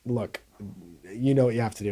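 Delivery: low, very soft retold counsel; imitating a solemn private instruction; grave hushed urging. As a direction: drop low and quiet, as if confiding. Retelling a grave final film moment.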